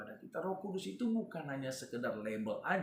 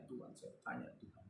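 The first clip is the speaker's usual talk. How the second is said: much lower, whispered aside to God; whispered prayer; 12 semitones lower, 12 dB quieter than usual. Retold or imitated. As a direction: breathe the prayer under your breath. Retold silent prayer.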